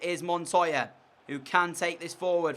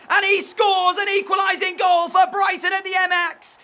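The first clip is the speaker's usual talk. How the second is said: much higher, much louder, jubilant commentator roar; goal celebration shout; 10 semitones higher, 9 dB louder than usual. Real, not acted. Player calling his own equalising goal, jubilant roar.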